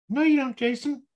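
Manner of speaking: high, loud, mocking little inner voice; subconscious voice. The subconscious scoffing at Jason's affirmation, high and mocking. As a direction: squeak it out like a doubting gremlin in your head. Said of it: "my subconscious voice".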